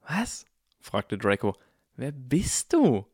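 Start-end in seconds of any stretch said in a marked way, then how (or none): 0.1-0.4 sharply higher, quick question; startled. A narrator's reading. Draco shocked by the revelation. 1.9-3.1 slow, higher, rising disbelief; astonished demand. A narrator's reading. Draco shocked, demanding identity.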